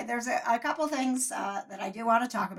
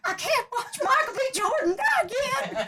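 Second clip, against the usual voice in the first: much higher Southern belle voice, mock-weepy; Jonna impression; 3 dB louder than usual. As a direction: do a hammed-up weepy Southern belle, near tears. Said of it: "Oh, your Southern Belle?".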